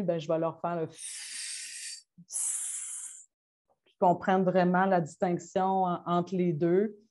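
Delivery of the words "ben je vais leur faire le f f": unvoiced "f", held as a long hiss; continuous f sound. Shown as a demonstration. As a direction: let "f" hiss on without stopping. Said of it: "le bruit continu". (« f » soufflé longtemps, sans voix)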